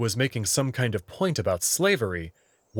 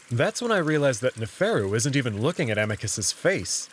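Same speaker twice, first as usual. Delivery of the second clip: usual speaking voice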